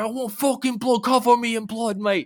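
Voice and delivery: high, loud, manic Australian cartoon shriek; Australian Rick voice. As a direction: squeal it like a bloodthirsty Aussie knife. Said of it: "talks like Australian Rick".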